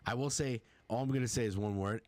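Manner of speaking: ordinary delivery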